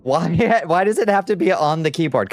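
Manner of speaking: amused, fed-up plea at a pet; laughing exasperation. Real, not acted. Cat stomping on keyboard.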